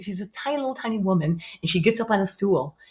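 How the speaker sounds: usual speaking voice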